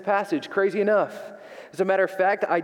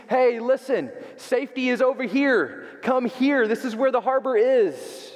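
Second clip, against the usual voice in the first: higher, calling out hey listen; mock scream; 7 semitones higher than usual. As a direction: call out like a beacon shouting. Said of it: "the lighthouse screams".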